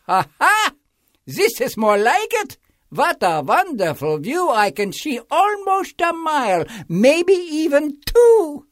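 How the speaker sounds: high, loud delighted crowing; gloating King Yertle. A narrator's reading. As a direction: crow over the view from the top. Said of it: "Yertle gloats atop the stack".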